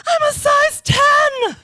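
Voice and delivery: high, drawn-out proud shout; literal yell. Retold or imitated. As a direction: yell it out for the whole house. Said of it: "I would literally yell out".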